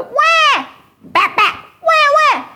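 high mock baby wail "Wah!" with slaps; imitating a baby's high crying wah. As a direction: squeal like a bawling baby. Baby cries when hit.

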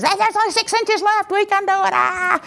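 high, frantic football-fan yell; going nuts. Acted. Fan at a football game going nuts for six inches. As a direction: scream it like a crazed fan. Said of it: "going nuts for six inches".

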